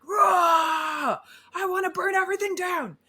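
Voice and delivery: high, fast furious outburst; angry roar. Retold or imitated. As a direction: let out a roar of rage. Imagined rage outburst.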